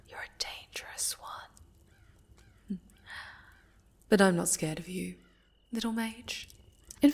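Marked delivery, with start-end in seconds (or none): 0.1-2.8 slow, high, airy whisper; breathy whisper. Acted. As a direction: let it drift out as a slow secret. Sorceress sizing up captive.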